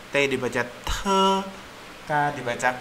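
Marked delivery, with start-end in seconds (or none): 0.6-1.3 "te" with a puff of air after the T; aspirated T. Shown as a demonstration. Teacher showing how T is read, aspirated "te". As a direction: sound out "te" with breath pressure. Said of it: "ada tekanan udaranya".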